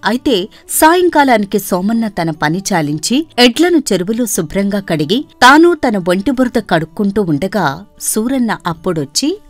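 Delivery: ordinary delivery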